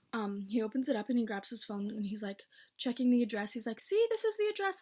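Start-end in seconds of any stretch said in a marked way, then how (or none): 3.8-4.8 put-on high voice, insisting; high-pitched insistence. Delivery man showing his phone, high-pitched insistence. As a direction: pitch it way up to prove the point.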